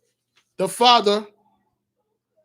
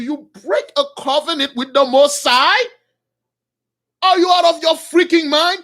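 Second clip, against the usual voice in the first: loud, raised, incredulous preaching; indignant outburst; 5 semitones higher, 5 dB louder than usual. Real, not acted. Preacher warning brothers about breaking a covenant, indignant. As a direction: hurl the questions out high and loud.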